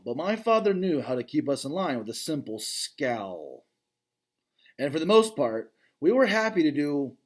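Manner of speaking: ordinary delivery, nothing marked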